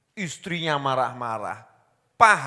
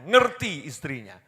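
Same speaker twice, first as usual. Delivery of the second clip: normal talking voice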